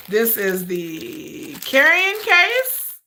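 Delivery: ordinary delivery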